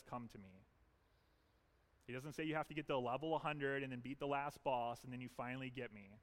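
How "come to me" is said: gentle, inviting Jesus; quietly welcoming, sincere; tender invitation. Retold or imitated. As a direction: offer it softly like an open door. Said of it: "He says".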